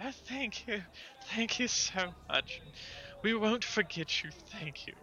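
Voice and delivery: high-pitched little character voice; grateful Mumsy. Acted. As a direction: pipe up with high, grateful thanks. Voices Mumsy thanking the party.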